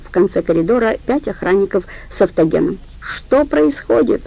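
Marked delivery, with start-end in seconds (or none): 3.0-4.2 slow, higher bellowed question; yelling. A narrator's reading. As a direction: yell the question out slowly. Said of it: "заорал Валера". (протяжный крик, выше)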